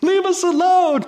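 high-pitched, drawn-out mock yell; retold yell. Retelling sitting straight up in bed, mock yell. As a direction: re-enact a startled yell, pitch leaping way up. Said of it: "and I yelled".

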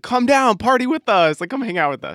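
high-pitched calling out to someone; retold yelling. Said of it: "yelling at this guy".

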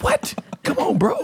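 squeaky, high-pitched disbelief; mock exasperation. Man scolding himself for blurting it out, high mock exasperation. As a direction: protest in a comic falsetto. Acting out dismay at his own blurting.

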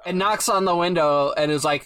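normal talking voice

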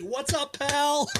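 sugary high greeting; high sweet voice. Retold or imitated. Mock sweet voice for wife.

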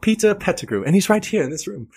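high-pitched, put-on character voice; exaggerated high film-quote voice; film character impression. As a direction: pitch it way up in a mock movie voice. Acting out a scene from the film.

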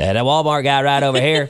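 comic Walmart-guy character voice. Shown as a demonstration. Co-host requests his Walmart guy, comic character voice. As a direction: play the Walmart guy for laughs. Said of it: "do the Walmart guy really good".

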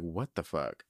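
low, soft stunned question; confused low gasp. Pretend. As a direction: sound utterly stunned. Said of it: "They're gonna be, like, really fucking confused".

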